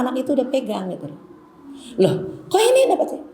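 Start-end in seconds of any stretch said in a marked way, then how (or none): 2.0-3.2 high surprised question; mock surprise on finding it; surprised Loh, pitched up.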